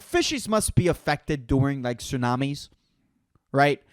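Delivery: ordinary talk, nothing marked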